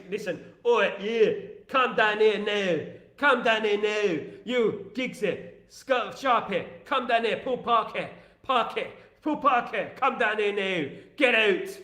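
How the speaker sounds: slow, drawn-out orders in a put-on manager's voice; Sir Alex Ferguson. Manager ordering players out of a party house, drawn-out put-on voice. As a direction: call them down like an angry football manager. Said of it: "Sir Alex Ferguson used to knock on his door".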